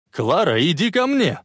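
calling out. A narrator's reading. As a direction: urge someone over from afar. Calling her across the moving train. (зовущий крик)

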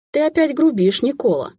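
high, chiding schoolteacher tone; displeased teacher. A narrator's reading. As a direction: scold him like a disappointed teacher. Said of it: "тоном недовольного учителя".

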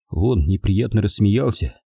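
plain reading voice

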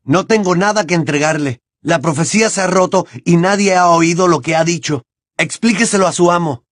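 high-pitched defiant yell, not full force; Harry shouting. A narrator's reading. Harry telling Bellatrix the prophecy broke, shouted. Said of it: "gritó Harry".

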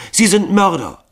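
very high, loud accusation; outraged, piercing outcry; accusing. A narrator's reading. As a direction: cry it out as a charge against them. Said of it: "wiederholte sie ihre Anschuldigungen".

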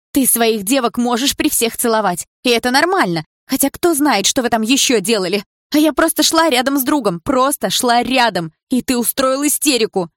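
loud, high, indignant yelling; furious shouting. A narrator's reading. Girl keeps shouting at Bars about his kisses, loud. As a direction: hurl the accusation at him.

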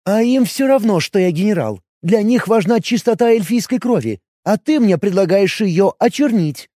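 jealous elf questioning her man; elf woman voice. Acted.

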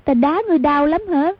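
a hint of a childlike girl asking with concern; simple girl asking. A narrator's reading. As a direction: naive girl's worried question. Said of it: "hỏi".

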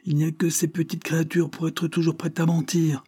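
high-pitched pious outrage; wronged, exasperated exclamation; exclaimed protest. A narrator's reading. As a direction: appeal to heaven against these little liars. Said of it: "s'écria Tiffel en levant les yeux au ciel".

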